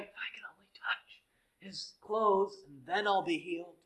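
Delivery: hushed unvoiced whisper of a hopeful woman. The bleeding woman whispering to herself behind Jesus. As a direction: breathe it secretly, hoping no one hears.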